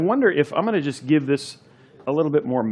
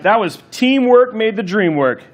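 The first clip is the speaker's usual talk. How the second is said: triumphant; 7 semitones higher, 5 dB louder, slower than usual. Real, not acted. Celebrating finished step.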